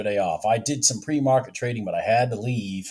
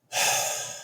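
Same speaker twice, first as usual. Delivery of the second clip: heavy sigh; 4 dB quieter than usual. Real, not acted. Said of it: "I'm breathing hard there".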